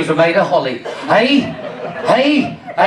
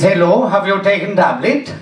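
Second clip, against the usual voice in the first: put-on Indian accent; 4 semitones lower than usual. Doctor greeting the returning patient, Indian accent. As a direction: lower mock Indian accent. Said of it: "He was an Indian doctor".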